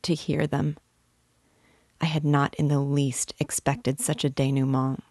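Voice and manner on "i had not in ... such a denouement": ordinary delivery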